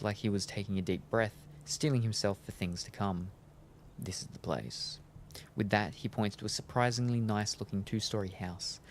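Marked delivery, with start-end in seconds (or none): 4.0-5.0 low, soft, slow vampire guide; grim Nosferatu. Acted. Vampire guide points out the house, low and subdued. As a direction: drop down low and slow it right down.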